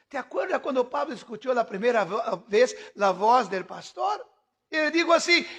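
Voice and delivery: ordinary delivery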